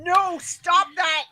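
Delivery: mock commanding voice, loud and drawn out; authoritative AI. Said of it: "get really authoritative about something".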